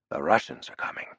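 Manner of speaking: hushed, ominous whisper. A narrator's reading. Narrator's hushed warning as the enemy appears, whispered. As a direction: breathe it like a dread secret. Ominous aside after hearing accent.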